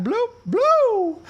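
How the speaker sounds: high, loud squeaky "Bloop, bloop"; mock scanner beep. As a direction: beep like a card reader.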